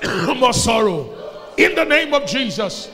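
shouted, slow declaration; preaching at full force. Acted. Pastor declaring to the congregation, full shout. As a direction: proclaim it over the crowd like a pastor.

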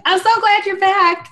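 high, squeezed delighted greeting; gushing relief. Acted. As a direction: squeal it fondly at a revived friend. Greets revived friend, patting her cheeks.

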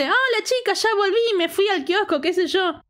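high, cheery mock hello; retold happy greeting. Ileana returns from the kiosk to the girls, imitated happy greeting. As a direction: imitate a friend arriving all delighted. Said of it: "re feliz".

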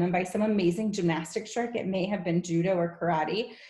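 ordinary delivery

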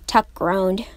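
usual speaking voice

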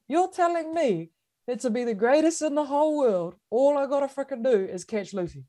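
high, disbelieving retort; incredulous. Retold or imitated. Runner weighing coach's promise, high incredulous voice. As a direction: pitch it up, amazed it could be that simple.

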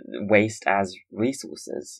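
slow, set apart as if in quotation marks; air quotes. Shown as a demonstration. Guest making a point on the podcast, slow and deliberate. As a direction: lean on waste as resources, slowly. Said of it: "I'm doing quotation marks with".

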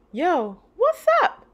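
high, slow, loud casual hey; cool kid greeting. Acted. A kid greeting the girl, casual and loud.

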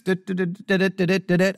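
low hummed news jingle, badly done; mock the news intro with du du du; breaking news jingle. Host mocks a newscast with a low jingle. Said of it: "that's not a very good breaking news sound".

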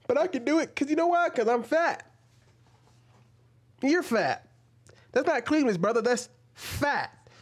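high, emphatic debunking; incredulous retort. Real, not acted. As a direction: pitch it way up to hammer the point. Argues back at the clip.

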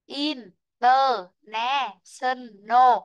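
"International" very slow, syllables pulled apart; counting syllables. Shown as a demonstration. Teacher counts the syllables aloud with the class, very slow. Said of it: "chúng ta sẽ đếm này".

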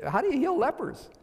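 high-pitched, incredulous question; acted bewilderment, pitch leaping up; baffled disbelief. King asked to cure leprosy, baffled disbelief.

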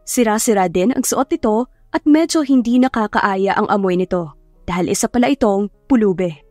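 usual speaking voice

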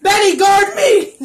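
loud high call for help; mock-panicked cry; playful shout. Kid plays scared, calls toy.

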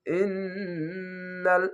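ordinary talk, nothing marked

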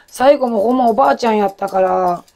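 usual speaking voice

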